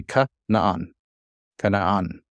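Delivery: "ka naan" with a hard K and short first vowel; hard K, then "naan" drawn out; hard kaf pronunciation. Shown as a demonstration. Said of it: "this would be a hard".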